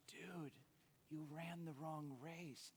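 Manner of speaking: slow whispered gloat; buddy teasing. Retold or imitated. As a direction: whisper the told-you-so. Buddy teasing at finish line.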